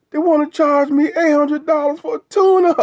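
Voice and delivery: very high, strained, whiny mock-crying complaint; imitating a tearful man. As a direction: play him near tears, voice climbing high. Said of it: "this man was in tears".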